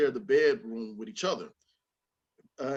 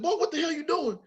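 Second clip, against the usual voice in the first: high-pitched mock angry dad; scolding father. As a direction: play a woken dad scolding his boy. Voices his woken father scolding.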